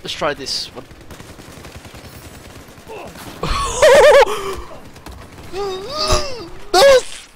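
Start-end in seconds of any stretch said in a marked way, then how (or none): 0.9-6.7 high, loud burst of laughter. Real, not acted. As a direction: crack up hard. Gamer cracks up.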